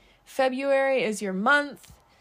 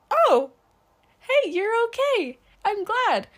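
put-on very high, loud little-kid voice; sweet, squeaky mock-toddler delight; relieved toddler; 11 semitones higher, 7 dB louder than usual. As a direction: imitate a toddler happily relieved. Acting toddler relieved mom stopped crying.

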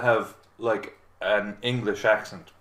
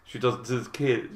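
ordinary talk

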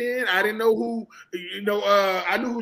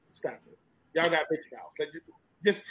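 dismissive murmur; 6 semitones lower, 7 dB quieter than usual. Real, not acted. Dismissive, very soft.

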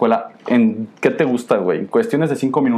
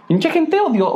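outraged; slower than usual. Real, not acted. Outraged at hateful girls.

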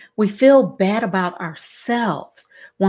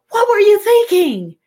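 mock-exasperated, high and loud; emphatic questioning; 12 semitones higher, 6 dB louder than usual. Parent to child with peas up the nose, loud emphatic disbelief. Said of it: "with a lot of emphasis".

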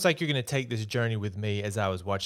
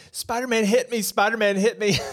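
higher, louder whiny fan complaint; mock shrieking woman; 10 semitones higher, 5 dB louder than usual. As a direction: squeal it like a clingy fan crying foul. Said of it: "and she starts saying".

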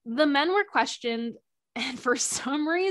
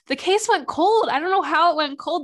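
higher, louder mock praise; sarcastic praise; 5 semitones higher, 6 dB louder than usual. Mocking the police for lazy work, loud sarcasm.

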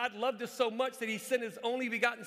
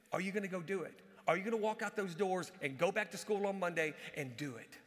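ordinary talk, nothing marked

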